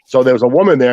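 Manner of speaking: usual speaking voice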